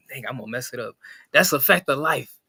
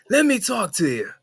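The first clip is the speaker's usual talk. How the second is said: L A Knight at a buffet; 9 semitones higher than usual. Retold or imitated. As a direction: bark out orders like a brash wrestling star. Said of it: "I can see us walking into a buffet and he".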